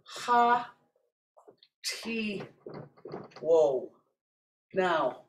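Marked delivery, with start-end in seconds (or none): 0.0-3.9 "ha ti wo" slow, each syllable apart; model word, syllables apart. Shown as a demonstration. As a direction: lay out "ha ti wo" one syllable at a time. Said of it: "we would say".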